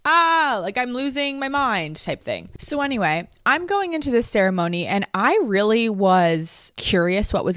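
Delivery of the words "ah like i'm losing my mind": hammed-up losing-it voice; mock freak-out. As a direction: play up a silly panicked cry. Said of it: "you're not gonna be like".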